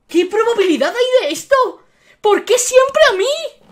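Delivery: high-pitched protest at bad luck; exasperated disbelief. Real, not acted. Streamer gets a duplicate icon, exasperated disbelief. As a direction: wail the questions, pitch climbing.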